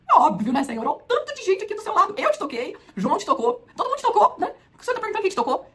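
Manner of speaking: high, loud exasperated protest; incredulous Peter. Acted. Peter protesting to Jesus in the crowd, high and exasperated. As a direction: scoff at the obvious, voice shooting up.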